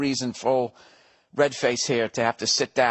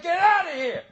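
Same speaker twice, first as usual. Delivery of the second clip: much higher shouted order; mock shout. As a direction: bark them out of the country. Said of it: "I said".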